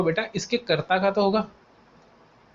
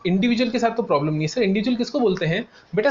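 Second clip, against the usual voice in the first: ordinary delivery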